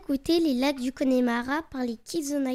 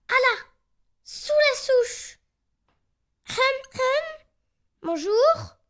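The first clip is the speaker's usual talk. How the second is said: excité; 8 semitones higher, 6 dB louder, slower than usual. Acted. Cochon d'Inde découvre un terrier.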